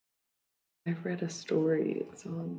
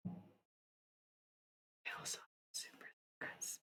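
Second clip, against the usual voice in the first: whisper; 19 dB quieter, much slower than usual. Pretend. Teasing viewers about secrets.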